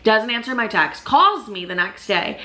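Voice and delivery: normal talking voice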